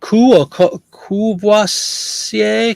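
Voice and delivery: loud, drawn-out French "Courvoisier" with the French "w" sound; hammed-up French. Shown as a demonstration. Showing off the brand name to viewers in a loud, slow mock French. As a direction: stretch Courvoisier out slow and grand, rolling it French. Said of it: "attempting French name".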